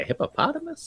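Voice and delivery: squeaky high voice. Pretend. Silly high voice aside.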